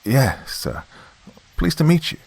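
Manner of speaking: put-on slightly stuttering, nervous young man; a touch of nervous stammer. Young technician nervously answers the old reverend, slight stutter. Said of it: "Slightly stuttering, I answered".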